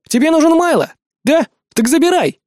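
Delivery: high, loud exasperation; fed-up outburst. A narrator's reading. Hero fed up with both sides, irritated. Narrator's hero loses patience.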